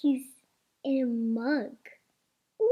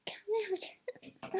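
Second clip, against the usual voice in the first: pleading whisper; 7 semitones higher, 9 dB quieter than usual. Real, not acted. Pleading with stuck toy.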